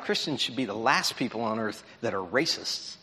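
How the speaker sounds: ordinary talk, nothing marked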